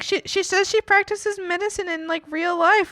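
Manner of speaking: high mom voice; mom imitation. Mom vouching for the saleswoman, high. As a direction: play mom vouching for her.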